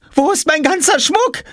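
very high shrill cry from afar; shrieking. A narrator's reading. Jule shrieking from the house, panicked. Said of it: "Jule kreischte".

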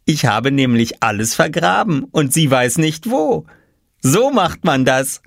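put-on high, smug child voice; lightly voiced cocky little boy. A narrator's reading. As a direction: brag about the plan. Oskar boasting of his trick.

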